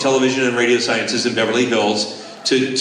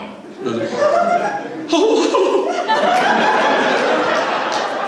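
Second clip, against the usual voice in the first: big laugh. Real, not acted. Laughing after the praise.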